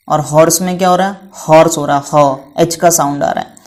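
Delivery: normal talking voice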